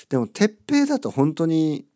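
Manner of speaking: normal talking voice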